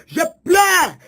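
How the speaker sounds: high, loud wail; impassioned wailing shout; anguished lament. Acted. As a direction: cry it out in prophetic grief.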